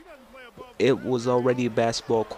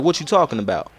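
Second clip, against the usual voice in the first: loud retort; 5 semitones higher, 8 dB louder than usual. Retold or imitated. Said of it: "I'm like".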